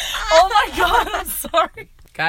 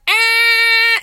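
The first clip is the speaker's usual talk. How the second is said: horn imitation; 12 semitones higher, 6 dB louder than usual. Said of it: "we're like".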